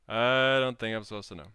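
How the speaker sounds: second take, loud and full; loud declaration. Shown as a demonstration. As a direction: proclaim it to the chat.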